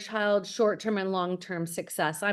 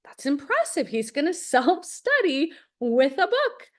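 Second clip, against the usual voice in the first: higher, mock impressed with a laugh; sarcastic amazement; 6 semitones higher than usual. Mother recalling her son's self-study plan, mock impressed and laughing. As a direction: play it dry, pretending to be impressed. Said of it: "And I'm like".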